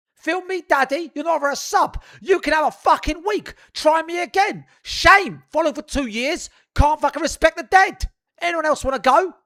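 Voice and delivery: loud, high confrontational yelling; angry shouting. Real, not acted. Streamer banning a disrespectful viewer, furious challenging shout. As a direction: shout down the whole chat, punching out each threat.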